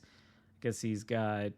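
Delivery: usual speaking voice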